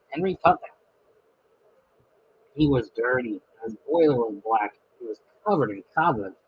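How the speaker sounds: steady storybook reading voice; story narration. A narrator's reading. Narrator reading the railway story aloud, steady storybook voice. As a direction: tell the tale to a child.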